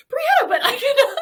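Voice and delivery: high, loud protest through laughter; hammed-up squeal of complaint; shocked protest. Slapped friend protesting to the slapper, shocked.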